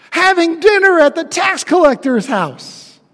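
usual speaking voice

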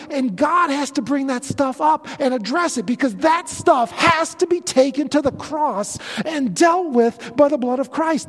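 impassioned sermon climax with pounding emphasis; preaching at full force. Acted. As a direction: preach it out to the congregation.

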